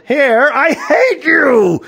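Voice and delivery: loud, high, drawn-out angry yell. A narrator's reading. Man yelling at the hair on him, angry yell. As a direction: yell hatefully, stretching the words. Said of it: "The man yelled".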